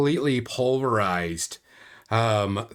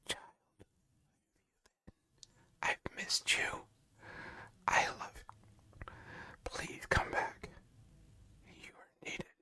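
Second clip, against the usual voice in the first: soft unvoiced whisper, ever fainter; a voice whispering in the hero's mind; 17 dB quieter than usual. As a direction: whisper it inside their head. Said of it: "very, very quiet".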